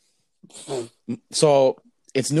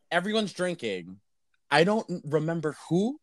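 usual speaking voice

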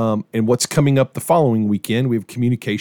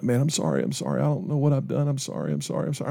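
soft, repeated apology, mimicking an upset son; choked up; 8 dB quieter, faster than usual. Injured son apologizing to his dad on the phone, softly imitated choked-up voice. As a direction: drop the volume as if choking up. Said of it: "choked up".

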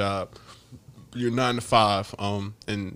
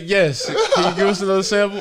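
laughing; 8 semitones higher, 6 dB louder than usual. Retold or imitated. Nurse quoted while laughing.

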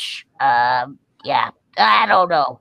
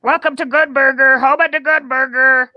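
high, loud fast-food greeter; Good Burger voice; 5 semitones higher, 4 dB louder than usual. Acted. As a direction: belt out the greeting in a raised character voice. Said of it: "can I hear your Good Burger voice?".

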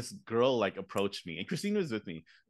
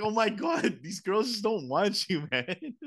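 high-pitched mock exasperation; retold exasperation; 10 semitones higher, slower than usual. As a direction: despair at a clueless cousin, pitched way up. Retold exasperation at his deluded cousin.

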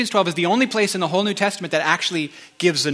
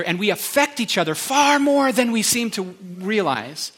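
higher and louder, faster, urging them; passionate plea; 5 semitones higher, 5 dB louder than usual. Real, not acted. Pastor urging the church not to pull away, higher and louder. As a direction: press the point with real urgency. Pleading with the congregation.

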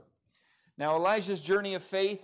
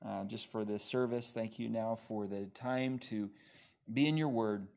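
low, soft spoken prayer; 6 semitones lower, 6 dB quieter than usual. Real, not acted. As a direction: pray quietly on behalf of the congregation. Let's have a real quick word of prayer.

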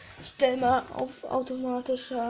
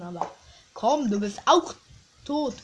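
ordinary talk

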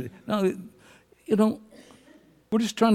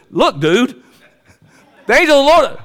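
donkey's warning; 11 semitones higher, 7 dB louder than usual. Acted. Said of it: "that donkey got up and said".

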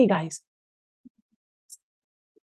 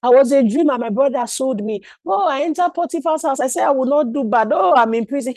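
high, hammed-up wail of self-pity; mock woeful lament; 4 semitones higher than usual. Prisoner declaring his woes to fellow prisoners, mock woeful lament. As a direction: bemoan your lot in an exaggerated singsong. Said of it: "declaring his woes".

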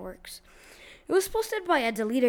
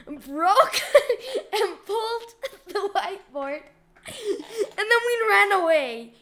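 laughing; 7 semitones higher, 5 dB louder, slower than usual. Real, not acted. Laughing while telling prank.

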